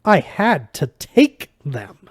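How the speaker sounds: put-on slow, emphatic French-accented disbelief; drawn-out, offended fox god; incredulous at the rudeness. A narrator's reading. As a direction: stress it as if affronted. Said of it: "like he couldn't believe the rudeness of it".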